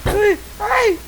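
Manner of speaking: shrill high-pitched panic; high panicked squeal. Pretend. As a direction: squeal it out in alarm. Character being crushed in game.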